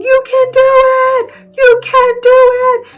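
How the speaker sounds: high, bright encouraging cheer; squeaky little voice urging you can do it; cheering good thoughts. Acted. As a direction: cheer him on like a tiny coach. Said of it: "his good thoughts are saying".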